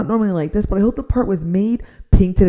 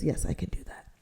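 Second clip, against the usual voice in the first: whispered quote; 6 semitones lower, 15 dB quieter than usual. Retold or imitated. Quoting agent, whispered.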